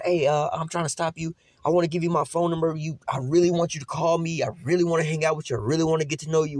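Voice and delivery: hammed-up flirt; smooth flirting guy. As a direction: play the flirty guy chasing a woman. Mock man hitting on woman.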